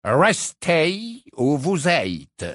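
very slow, drawn out, stress on vous; slow haughty portrait. Acted. Portrait delivering Dumbledore's order, slow and haughty. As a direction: spell out each word with condescension. Phineas relays the message.

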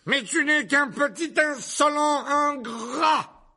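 slow, very high shouted insult; furious yelling. A narrator's reading. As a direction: bellow each word in rage. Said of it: "hurla la tante Marge en s'enflant de colère". (hurlement furieux, mots étirés)

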